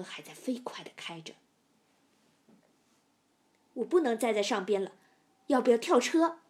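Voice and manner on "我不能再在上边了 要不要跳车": loud, urgent inner panic; alarmed. A narrator's reading. Trapped passenger debating a jump from the car, alarmed. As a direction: blurt it out loudly as panic mounts. Frightened passenger thinks of escaping.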